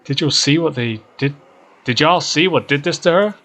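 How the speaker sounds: upset Duke. Acted. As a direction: demand to know who did this. Duke demanding who hurt Birdie.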